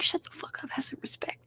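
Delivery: soft unvoiced whisper, dismissive; hissed comeback. Retorting to friends who knock the movie, in a soft whisper. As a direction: whisper it like a put-down. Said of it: "I'm like".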